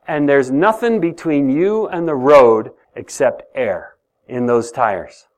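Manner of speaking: usual speaking voice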